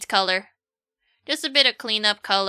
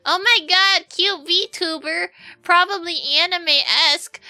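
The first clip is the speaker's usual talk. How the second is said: high, excited anime-fan voice; gushing fan; 5 semitones higher, 4 dB louder than usual. Acted. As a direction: squeal like an eager fan meeting a cat girl. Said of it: "people just make the assumption".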